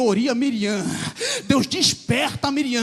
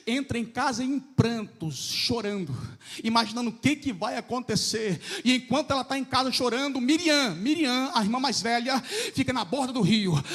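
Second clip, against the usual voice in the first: normal talking voice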